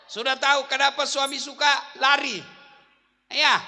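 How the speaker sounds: loud, high punchline delivery; lively preaching. Acted. Preacher delivering the joke's lesson to wives, loud preaching. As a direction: hammer the joke home to the mothers.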